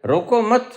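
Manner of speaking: "रोको मत" high and joined, then halt; stop after मत. Shown as a demonstration. Teacher moving the pause to flip meaning, stop after मत. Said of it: "यहां ठहरो".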